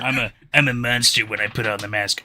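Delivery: hammed-up teen killer; mock edgy monster voice. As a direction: sneer it like a wannabe monster. Mocking edgy killer line.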